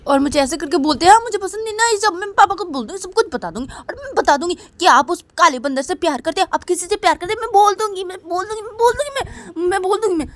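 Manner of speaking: put-on tattle like a cross little girl; little sister threatening to tell papa, high and whiny. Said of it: "बोलती कि".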